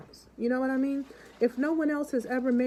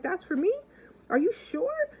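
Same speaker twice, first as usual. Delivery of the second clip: much higher shocked disbelief; astonished; 8 semitones higher than usual. Retold or imitated. Old lady stunned by the cash gift, much higher. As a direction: squeal in disbelief. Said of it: "She was like".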